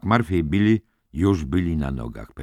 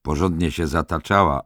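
usual speaking voice

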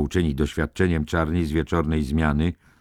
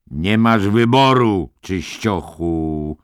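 loud, slow orderly's voice with a trace of sadness; a towering orderly, regretful yet threatening; sad-tinged menace; 4 semitones higher, 6 dB louder, slower than usual. A narrator's reading. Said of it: "jakby ze smutkiem w głosie". (głośno, powoli, z nutą smutku)

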